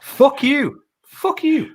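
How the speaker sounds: high, loud cursing at a friend; shouted banter, pitch leaping up; indignant outburst. Real, not acted. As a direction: burst out in high-pitched protest. Heated mock row over RTA.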